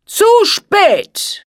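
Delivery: high, loud, furious hiss. Acted. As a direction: spit it out in fury. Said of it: "zischte er".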